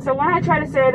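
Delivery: ordinary talk, nothing marked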